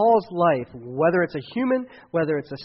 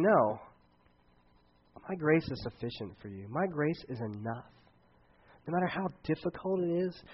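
tender sermon promise; 3 semitones lower, 8 dB quieter than usual. Acted. Pastor gently assuring a worried congregation, soft and tender. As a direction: lay each promise down gently, almost hushed. Preaching God's promise softly.

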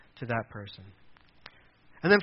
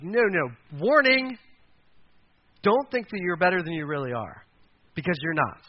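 Paul's warning; 3 semitones higher, 4 dB louder than usual. Retold or imitated. Paul rebuking the proud listener, a loud stern warning. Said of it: "Paul's like".

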